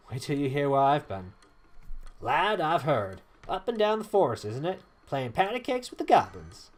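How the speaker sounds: put-on lilting southern accent for a game character. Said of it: "a southern accent".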